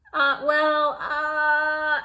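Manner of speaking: high, loud flustered stammer; nervous hesitation. Acted. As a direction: caught out and panicking. Varmint nervously backtracks.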